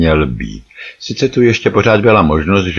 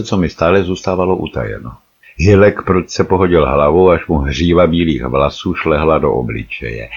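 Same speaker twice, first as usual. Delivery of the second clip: usual speaking voice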